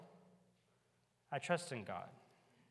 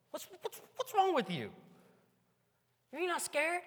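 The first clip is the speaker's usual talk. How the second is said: very high, squeaky disbelief; high mock astonishment; incredulous grandmother; 4 dB louder than usual. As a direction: play a shocked grandma asking the boy. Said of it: "she's like".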